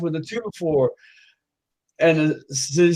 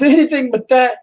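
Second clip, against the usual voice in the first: high, loud, urgent reply; desperate plea; 10 semitones higher, 5 dB louder than usual. Acted. Customer answering the woman's offer, desperate. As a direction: blurt it out desperately.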